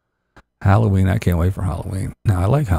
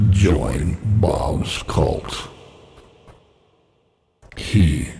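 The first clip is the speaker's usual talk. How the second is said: slow solemn intoning; 5 semitones lower, 3 dB quieter, much slower than usual. Pretend. Cult recruiting pitch.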